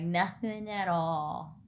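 drawn out, slow and flat; slow denial. Retold or imitated. Boyfriend, stonewalling her, slow denial. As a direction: stretch each word, slow. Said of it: "he's like".

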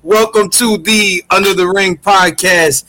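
grand entrance announcement. Acted. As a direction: boom it out like a ring announcer. Podcast host opening announcement.

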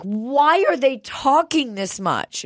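put-on high, slow whiny little boy; cranky kid voice. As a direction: whine it like a fed-up six-year-old. Kid complaining in restaurant.

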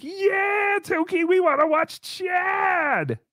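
put-on high, slow, shouted cheer like a crowd of kids; mock kids cheering. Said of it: "I need to get some kids cheering".

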